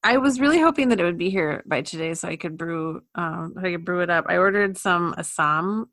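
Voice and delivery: ordinary talk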